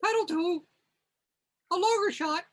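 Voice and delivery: high, reedy frog-puppet voice; Kermit voice. Acted. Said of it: "I'll try Kermit".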